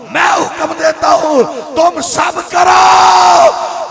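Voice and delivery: loud, ringing sermon delivery; preaching at full force. Acted. Preacher rousing the congregation, loud impassioned preaching. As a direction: proclaim it to the whole mosque.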